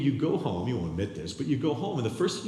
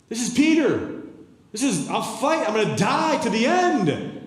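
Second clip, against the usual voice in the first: high, loud mocking bravado; loud high hammed-up boast; mock boastful Peter; 11 semitones higher, 7 dB louder than usual. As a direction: lampoon Peter's big talk.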